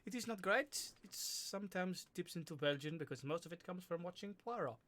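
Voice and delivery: chatty stage-French accent. Shown as a demonstration. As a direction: put on a Poirot-like French accent. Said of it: "French is an accent I can do".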